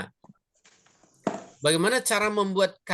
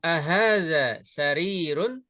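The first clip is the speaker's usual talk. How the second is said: "Ahadza sarirun" slow, rising at the end; question intonation; slower than usual. Shown as a demonstration. Teacher models a yes-no question for students, questioning "Ahadza sarirun". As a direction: ask "Ahadza sarirun" drawn out, with a final lift. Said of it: "Intonasi istifham".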